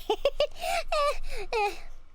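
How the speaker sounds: very high, squeaky laughter; real high laugh. Real, not acted. Streamer joking about being scared, high laughter. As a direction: burst into high giggles.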